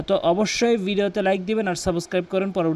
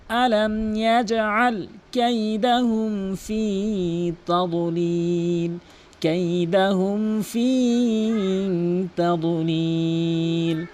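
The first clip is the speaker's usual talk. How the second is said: slow, long-drawn tajweed chant; measured Quranic chanting; Quran recitation; much slower than usual. Acted. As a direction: recite with stretched, sustained vowels.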